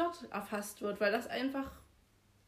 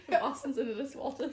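laughing; 4 semitones higher, 3 dB louder than usual. Real, not acted. Joking about the cold toilet.

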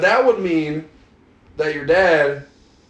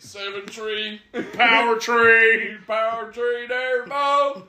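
slow, high, stretched-out Southern drawl; mock Southern accent; 5 semitones higher, much slower than usual. Friend mocking how truck guys say three, Southern drawl. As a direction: drag it out as a hammed-up Southerner.

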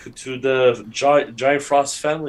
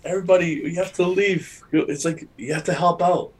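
urgent announcement; 6 semitones higher than usual. Retold or imitated. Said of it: "there's like".

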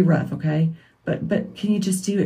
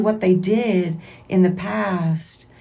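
ordinary talk

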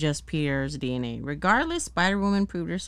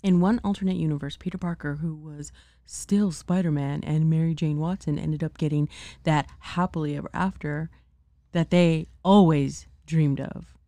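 commercial voice; 4 dB louder than usual. Pretend. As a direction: sell it like a TV ad. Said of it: "I'm trying to do my commercial voice".

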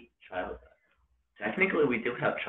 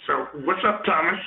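loud, high puppet-frog greeting; Kermit the Frog; 9 semitones higher, 7 dB louder than usual. Acted. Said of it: "in the Kermit voice".

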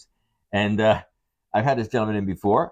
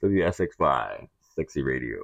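sexy radio voice; 7 semitones lower than usual. Acted. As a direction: purr it like a late-night station ID. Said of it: "that sexy radio voice of his".

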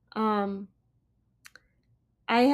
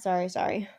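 usual speaking voice

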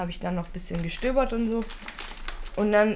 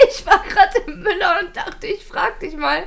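Mutterzitat, höher und immer wieder vom Lachen unterbrochen; lachend nachgemacht; 8 semitones higher, 7 dB louder than usual. Retold or imitated. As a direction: vor Lachen kaum weitersprechen können. Quoting mother while laughing. (erzählt die Worte der Mutter unter Lachen)

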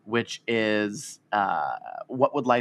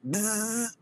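buzzing like hair clippers; 11 semitones higher, 6 dB quieter than usual. Pretend. Joking about shaving his beard, clipper buzz. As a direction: mimic an electric trimmer. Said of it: "clippers buzzing".

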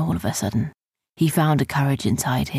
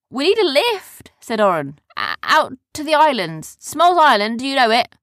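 calling up; 8 semitones higher, 6 dB louder than usual. Acted. Calling up to ship.

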